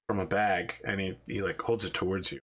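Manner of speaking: usual speaking voice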